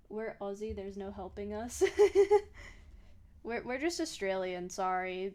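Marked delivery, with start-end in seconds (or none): 1.9-3.4 loud, high burst of laughter; sudden high cackling laugh. Real, not acted. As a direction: burst out laughing at your own joke. Laughs at being helplessly Aussie.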